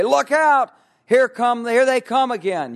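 high, loud warning call; mock alarm. Acting out raising an alarm.

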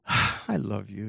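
slow, sighed adoration; gushing affection. Retold or imitated. Kristen to Steve over birthday tree, gushing. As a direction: sigh it out with mock tenderness. Imagined friend adoring the digger.

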